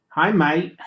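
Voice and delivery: half-hearted attempt at an Australian accent; amateur Australian greeting. Shown as a demonstration. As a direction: have a quick go at an Aussie accent. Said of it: "Australian accent".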